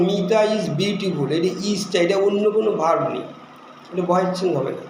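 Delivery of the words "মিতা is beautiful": slow example read. Shown as a demonstration. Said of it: "যেমন".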